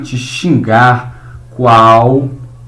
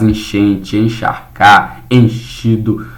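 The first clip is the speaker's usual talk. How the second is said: slow example word; 4 semitones lower, slower than usual. Shown as a demonstration. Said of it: "teacher models the word".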